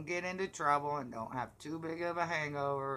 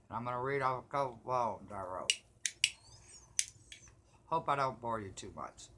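normal talking voice

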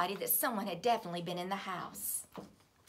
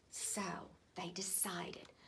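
whispered narration; 3 semitones lower, 8 dB quieter, slower than usual. A narrator's reading. Whispered storytelling.

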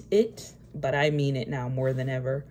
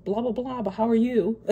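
much higher, airy mock-cheerful voice, laughing at the end; breezy brush-off; 9 semitones higher than usual. Mocking her old video self, lightly dismissive and cheerful. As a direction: imitate yourself glossing over it with fake cheer. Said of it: "I was kind of like".

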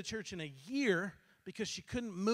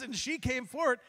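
normal talking voice

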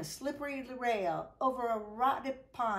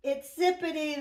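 louder, higher cry of discovery; loud joyful cry; 5 semitones higher, 6 dB louder than usual. Acted. Man and woman cry out finding lost Zippity, loud. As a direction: call out in happy relief. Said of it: "they cried".

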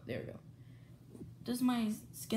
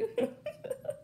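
airy high giggle; high breathless giggle; 5 dB quieter than usual. Real, not acted. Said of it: "I can't stop laughing".

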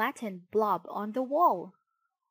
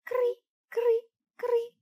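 very high imitated chirping; three squeaky cricket chirps; 5 dB louder than usual. A narrator's reading. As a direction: chirp like a tiny insect. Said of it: "buzzed a cricket".

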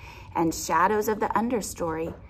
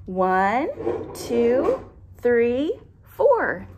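counting up, getting higher and louder; excited counting; 5 semitones higher, 5 dB louder, much slower than usual. Shown as a demonstration. Counting porcupines with a child, rising and louder. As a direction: build excitement on each number. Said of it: "Can we count 'em?".

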